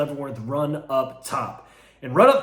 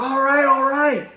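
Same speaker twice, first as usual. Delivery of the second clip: beaten man giving up; 9 semitones higher, 5 dB louder than usual. Retold or imitated. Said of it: "He's like".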